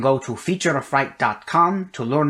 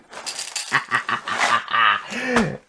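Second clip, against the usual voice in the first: mad scientist cackle; 3 semitones lower than usual. Acted. Mad science phase, gleeful.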